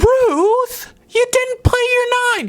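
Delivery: high, hammed-up angry shriek; furious grandpa. Grandpa losing it at grandma over Skip-Bo, mock-furious shriek. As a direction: imitate grandpa losing it, voice shooting way up. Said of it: "my grandpa would lose it".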